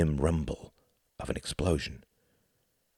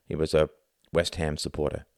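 plain reading voice

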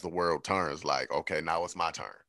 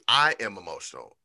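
ordinary talk, nothing marked

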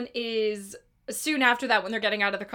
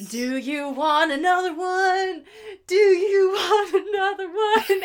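slow, high, building louder and breaking into laughter; imitating Meryl; 7 semitones higher, 5 dB louder, slower than usual. Fan imitating Meryl offering another song, slow and giddy. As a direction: drag it out like a giddy, dazed encore offer. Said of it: "and then she goes".

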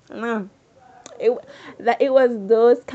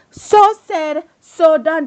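loud dramatic pronouncement; 5 semitones higher, 5 dB louder, slower than usual. Real, not acted. Dramatic catchphrase.

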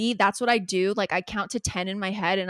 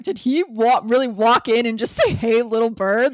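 louder, sarcastic echo of Val's outrage; mocking disbelief; 6 dB louder than usual. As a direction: scoff at the nerve of it, loudly. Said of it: "Val specifically is like".

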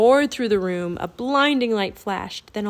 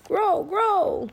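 high croaking bird call; raven croak; 10 semitones higher than usual. A narrator's reading. Rourke calling to the other ravens, croaking. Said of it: "Rourke croaked".

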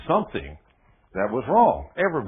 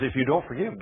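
ordinary talk

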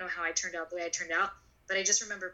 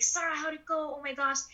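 hopeful mother; 8 semitones higher than usual. Retold or imitated. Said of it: "she's standing at the door waiting".

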